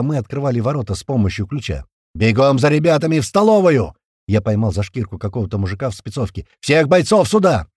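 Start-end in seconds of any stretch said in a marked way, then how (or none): 2.1-3.9 loud, high urgent command; shouted order. A narrator's reading. Narrator sending a man for reinforcements, shouting. 6.6-7.7 loud, high, drawn-out command; shouted order. A narrator's reading. Narrator sending a man for all fighters, shouting.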